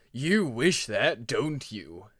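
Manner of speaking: slow, taunting unseen dragon; mock his hope, slowly; menacing voice. Shadow dragon taunting Peacemaker, slow and menacing.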